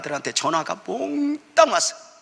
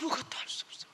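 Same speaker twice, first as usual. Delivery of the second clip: much softer breathy whisper; helpless whisper; 10 dB quieter than usual. Acted. Pastor whispers helplessness to the congregation.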